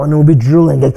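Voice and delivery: normal talking voice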